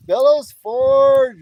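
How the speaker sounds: slow, loud, high calling of the name; drawn-out call. Real, not acted. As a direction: hail the viewer across the yard.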